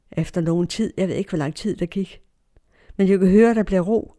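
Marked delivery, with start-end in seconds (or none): none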